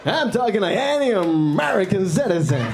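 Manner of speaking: over-enunciated, exaggerated American accent; put-on American accent. Driver answering the cop's question, in exaggerated American accent. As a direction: insist in a hammed-up American accent. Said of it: "why are you talking like that".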